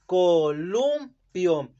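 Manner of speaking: "Columpio" split very slowly as co-lum-pio; "Columpio" broken very slowly into its syllables, co-lum-pio; slow syllabified. Shown as a demonstration. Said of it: "¿Cómo queda dividida?".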